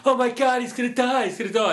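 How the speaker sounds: high, panicky mock shriek; hammed-up alarmed onlookers. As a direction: imitate the crew freaking out. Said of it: "they're all going".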